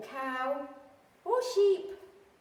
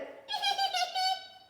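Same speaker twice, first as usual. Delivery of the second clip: high, squeaky giggle; piglets' giggle. Acted. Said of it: "all the piglets went".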